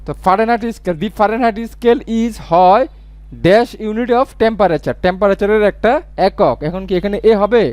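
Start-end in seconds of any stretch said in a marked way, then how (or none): 1.0-5.0 slow dictation. Shown as a demonstration. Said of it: "teacher reads example slowly".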